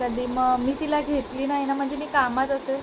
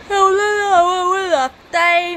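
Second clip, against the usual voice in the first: exaggerated exasperation; 6 semitones higher, 8 dB louder, slower than usual. Real, not acted.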